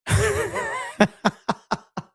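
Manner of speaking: sudden high laugh; high burst of laughter. Real, not acted. Streamer cracking up at a comment, high laugh.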